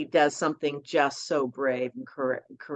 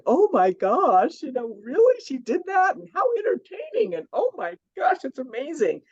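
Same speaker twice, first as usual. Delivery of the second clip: high, gushing imitation of astonished readers; playful mock disbelief, pitched way up; amazed gush; 11 semitones higher, 4 dB louder than usual. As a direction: mimic a stunned fan hearing gossip, pitch climbing. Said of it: "people will be going".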